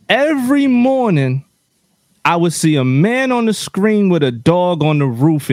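ordinary delivery

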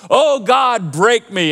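loud, high mock plea, falling away; worship song imitation. Pastor mimicking worship songs he can't sing, mock plea. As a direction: intone it like an overwrought worship lyric. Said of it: "in worship music, too, these songs".

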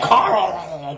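choked, strained Carl; imitating a choking cry of a name; strangled voice. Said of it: "It sounds like he's choking".

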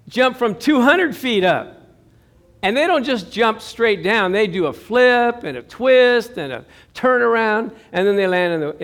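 usual speaking voice

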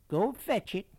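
high, loud Southern belle order; increasingly Southern lady. A narrator's reading. Lady urging the boy across the garden, Southern accent. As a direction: plantation lady's insistent Southern command. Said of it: "her voice becoming more southern".

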